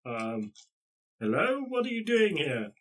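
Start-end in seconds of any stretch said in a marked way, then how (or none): 1.2-2.7 high-pitched call to passing strangers. Acted. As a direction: call out a question, pitch raised. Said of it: "they sort of shout out to you".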